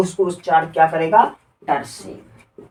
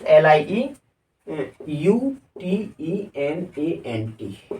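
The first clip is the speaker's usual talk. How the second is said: letters spelt very slowly and softer; slow spelling out; 3 semitones lower, 4 dB quieter, much slower than usual. Shown as a demonstration. Teacher spelling lieutenant for students, slow and soft.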